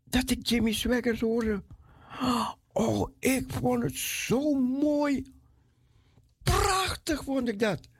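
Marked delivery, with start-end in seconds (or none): 2.7-7.9 higher, slow, swooning with delight; delighted awe. Real, not acted. Recalling first hearing the preacher on the radio, in delighted awe. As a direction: let the wonder lift the voice.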